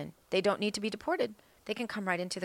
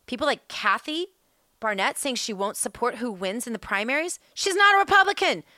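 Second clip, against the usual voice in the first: heated, much louder and higher; rising into a furious rant; angry; 7 semitones higher, 6 dB louder than usual. Real, not acted. Angry rant about candidate.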